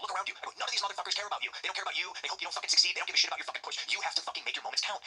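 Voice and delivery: high, urgent pep talk; rally him like a coach in the corner; retold pep talk. Firing up a scared rookie.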